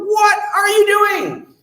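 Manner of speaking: startled yell at the innkeeper; shocked shout. Acted. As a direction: call out in disbelief.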